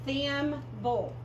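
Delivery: THIMBLE split as THIM, then BULL; syllable by syllable. Shown as a demonstration. Teacher shows B L E says bull, THIMBLE as THIM-BULL. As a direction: sound out THIMBLE in two parts, falling on BULL. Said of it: "B L E always says bull".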